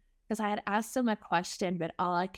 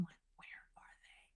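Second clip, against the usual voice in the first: barely audible reenacted whisper; anxious whisper; 28 dB quieter than usual. Foster mom watching the window for the baby, whispered. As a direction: whisper it to yourself, very faintly.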